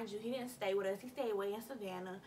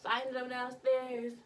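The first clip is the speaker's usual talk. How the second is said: ordinary talk, nothing marked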